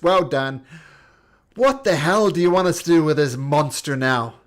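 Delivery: loud, high, furious carnival handler yelling; exasperated showman shouting over the noise; angry ringmaster. Acted. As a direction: bellow at them like a fed-up circus boss. GM voicing angry circus handler.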